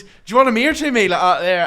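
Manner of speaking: high, loud, rapid threat; heated retold dare; acted aggressive challenge. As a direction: imitate someone squaring up for a fight. Retold threat to fight outside.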